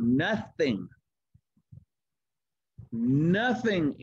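"nothing" stretched out, each one set apart by a pause; "nothing" said slowly and firmly, then repeated for weight; emphasis on nothing. Real, not acted. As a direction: land deliberately on each "nothing". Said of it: "I want to emphasize this word".

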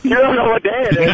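high incredulous laughing question; laughing disbelief; disbelieving. Acted. Staged disbelief at stranger.

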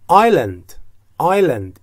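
آیلند said ay-lend with no s sound; silent s in island. Shown as a demonstration. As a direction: say آیلند without the s. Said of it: "این اس خونده نمیشه". (آیلند بدون صدای اس)

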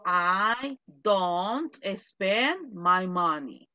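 "I don't spend my money" said very slowly word by word; teacher modelling very slowly for the class; slow model sentence. Shown as a demonstration. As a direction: dictate it slowly for students to repeat. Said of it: "otra ventaja es".

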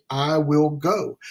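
"I will go" drawn out slowly; each word of "I will go" weighed; slow, stressed. Shown as a demonstration. Preacher listing the second action, slow and deliberate. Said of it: "the second part of that action is".

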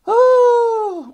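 very high excited ooh. Real, not acted. Reader reacting to a card, high excited ooh. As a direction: squeal at the next card.

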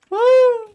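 sudden high shriek of fright; startled scream. Real, not acted. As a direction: yelp out in real shock. Sudden shock during gameplay. (ani korkuyla yüksek bir çığlık)

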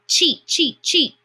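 high, loud repeated bird chirps; chattering sparrows. A narrator's reading. Sparrows swoop down at Peony, chattering high. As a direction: chatter it like scolding birds. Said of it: "they chattered at Peony".

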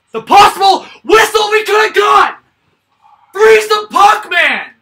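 loud high furious yell; raging gamer. Real, not acted. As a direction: scream it at the screen. Fan raging, repeated louder.

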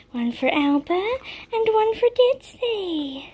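high, sing-song voice to the dogs. Real, not acted. Owner coos at her dogs over treats, high and sing-song. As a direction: coo at the pups to get them excited.

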